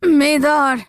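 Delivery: loud desperate call; anguished cry. A narrator's reading. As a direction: cry out in fear for her. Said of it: "the man cried".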